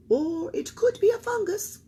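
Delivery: doctor voice. A narrator's reading. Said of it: "added Doctor Gourd".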